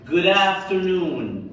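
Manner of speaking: Good afternoon drawn out very slowly, good running into afternoon; slow linked model. Shown as a demonstration. Teacher models the greeting: very slow drill. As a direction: stretch Good afternoon, linking the d. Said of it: "لا، جودا".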